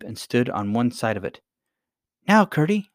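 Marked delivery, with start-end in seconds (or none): 2.3-2.9 high, louder call to push; cried out. A narrator's reading. Irene calling Gertie to push the slab, cried out. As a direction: cry out the signal. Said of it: "she cried".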